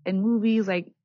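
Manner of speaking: high, eager driver voice; excited scooter driver. Retold or imitated. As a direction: gush like a chatty fan. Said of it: "He's like".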